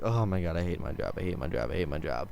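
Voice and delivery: low, soft, defeated grumble; a weary cook mumbling his despair; miserable cook. Retold or imitated. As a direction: moan it like a cook who hates his job. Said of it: "the cook's in the background like".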